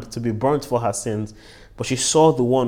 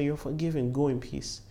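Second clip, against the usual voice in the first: much softer, tender pronouncement; gentle forgiveness; 3 semitones higher, 8 dB quieter than usual. Acted. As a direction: grant pardon softly, as Jesus to the woman. Jesus tenderly forgiving the woman.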